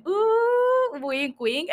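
long high teasing "ooh"; playful innuendo. Pretend. Teasing listeners about the couple christening the house, playful innuendo. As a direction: tease with a drawn-out, high-pitched ooh.

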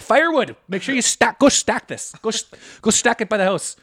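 high, loud orders; mock bossy dad. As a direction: boss the kid about the woodpile. Dad orders son to stack wood.

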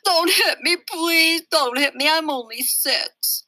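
put-on high, whimpering kid begging not to be hit; crying plea. Six-year-old begging a girl not to hit him, high and tearful. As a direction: beg through tears like a small child. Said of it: "Daniel started to cry".

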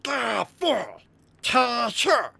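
high goblin voice repeating; goblin echo. Acted. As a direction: parrot it back like a wary goblin. Goblin echoes the words.

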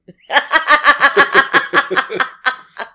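big burst of high laughter; loud high laugh. Real, not acted. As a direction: let out a loud, shrieking laugh. Loud laugh after joke.

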